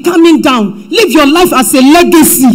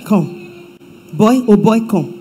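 much softer, lower, tender call; gentle call; 3 semitones lower, 5 dB quieter, slower than usual. Acted. God gently calling young Solomon, soft and low. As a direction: call the boy in softly.

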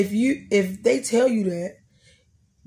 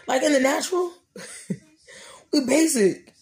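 laughing; 6 semitones higher than usual. Real, not acted. Laughing at own joke.

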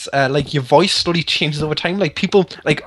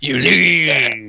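gruff wizard voice; 4 semitones lower than usual. Acted. Gamer voicing the wizard's lines for friends, low wizard voice. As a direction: lower put-on wizard voice. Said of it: "I'm not gonna read like that".

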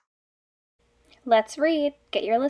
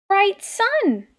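excited; 8 semitones higher than usual. Acted. Storyteller reveal.

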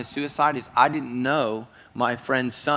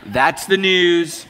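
mocking drawl; 5 semitones higher, 7 dB louder, much slower than usual. Real, not acted.

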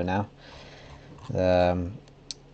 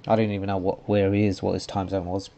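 normal talking voice

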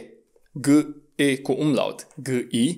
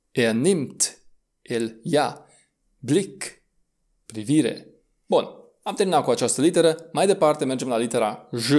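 usual speaking voice

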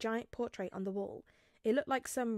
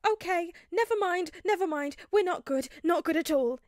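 high, loud, breathless panic; panicked between breaths; 8 semitones higher, 8 dB louder than usual. A narrator's reading. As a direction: gasp it out while running. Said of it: "he said between breaths".

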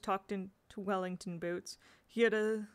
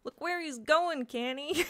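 loud, higher attempt at a Cornish accent; angry Cornish accent; 8 semitones higher, 5 dB louder, slower than usual. A narrator's reading. Boy knocked off his bicycle, angry Cornish accent. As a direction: snarl it in a rough, improvised Cornish accent. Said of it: "the Cornish accent made ugly by anger".

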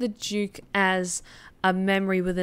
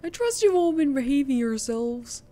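much higher, squeaky frog character; high grandiose frog voice; 9 semitones higher than usual. Acted. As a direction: talk like a pompous little cartoon frog. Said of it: "I'm a frog of a great many talents".

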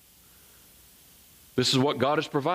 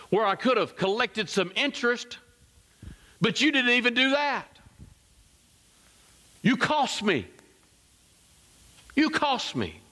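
high-pitched, preacher-acted reproach; indignant rebuke; 11 semitones higher than usual. Master to the servant who did nothing, indignant. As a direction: scold as the wronged master, pitch well up.

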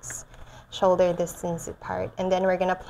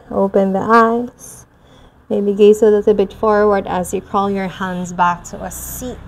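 soothing yoga teacher; 3 dB louder than usual. Acted. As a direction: ease them gently back into the body. Gentle return from meditation.

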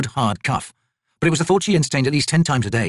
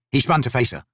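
ordinary delivery

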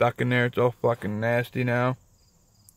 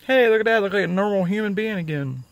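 pleased surprise; 8 semitones higher than usual. Real, not acted. Man showing viewers his new dentures, delighted. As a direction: show off the new teeth, pleased.

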